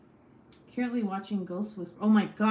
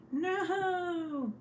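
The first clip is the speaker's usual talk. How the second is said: dismayed cry; 11 semitones higher than usual. Real, not acted. Stream breaking up, dismay.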